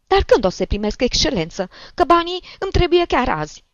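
put-on high, loud, insistent old woman; demanding old woman. A narrator's reading. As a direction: press urgently for an answer. Old woman demands her money.

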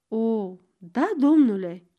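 ordinary delivery, nothing marked

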